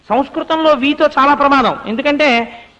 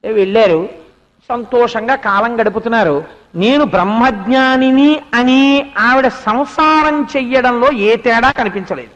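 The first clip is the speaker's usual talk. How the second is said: usual speaking voice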